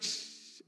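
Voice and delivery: voiceless hushing "shh"; imitated peeing noise. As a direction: imitate a trickle with a soft hiss.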